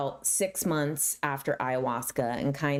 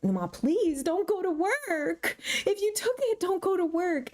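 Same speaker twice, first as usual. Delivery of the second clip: pleading. Retold or imitated. Teen begging mom to stay home, high pleading. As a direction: beg your mother not to go. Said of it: "And I'm like".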